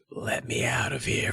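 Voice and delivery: unvoiced eerie whisper from the closet; spooky whisper, no voice at all. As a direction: breathe it like a ghost behind a door. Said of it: "Chris hears".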